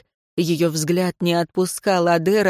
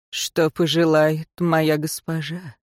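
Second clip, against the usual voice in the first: devoted servant; 3 semitones lower, slower than usual. A narrator's reading.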